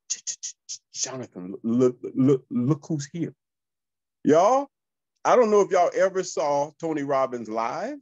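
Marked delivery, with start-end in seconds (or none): 0.1-3.3 stuttering, half-whispered alarm; panicked stammering whisper. Retold or imitated. Secretary backstage alerts Jonathan to a star guest, stammering in a hushed panic. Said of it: "freaking out over there".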